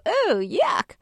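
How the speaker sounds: high, exaggerated disgusted reaction. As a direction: act out being grossed out, pitch swooping up. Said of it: "make a disgusted face".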